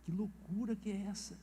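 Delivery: taken aback, said under his breath; quiet disbelief; shocked aside. Retold or imitated. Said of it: "Eu falei assim".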